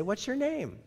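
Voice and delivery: high, innocent "What's your name?"; a politely curious victim; sweet mock question. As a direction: ask it brightly, high up.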